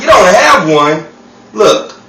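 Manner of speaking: can't believe it, draw it out; incredulous. Acted. Incredulous at missing washer.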